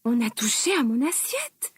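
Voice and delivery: plain reading voice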